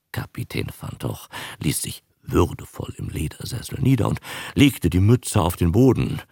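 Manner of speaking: plain reading voice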